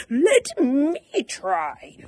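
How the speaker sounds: slow, loud, high eager whoop; loud drawn-out cheer. Acted. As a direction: whoop it out, keen to try. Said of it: "whooped Douglas".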